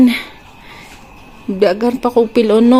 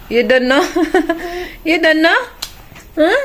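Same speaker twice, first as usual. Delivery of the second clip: 1 laughing tease; 7 semitones higher than usual. Real, not acted. Teasing baby with laughs.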